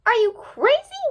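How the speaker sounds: high, loud, aghast gasp; shocked disbelief at the plan. Acted. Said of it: "Catpig gasped".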